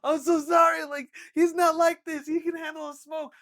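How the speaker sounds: high, loud, panicked apology; shouting sorry over the chaos; frantic apology. Retold or imitated. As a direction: beg forgiveness at full volume.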